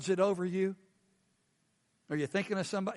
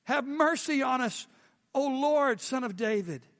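raised pleading cry; 6 semitones higher than usual. A narrator's reading. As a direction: call out for mercy from the roadside. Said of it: "cried out, saying".

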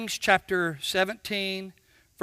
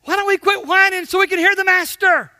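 fast, higher, louder challenge; exhortation; 8 semitones higher, 6 dB louder, faster than usual. Acted. Preacher urging the congregation to stop whining, fast and loud. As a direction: push the congregation hard.

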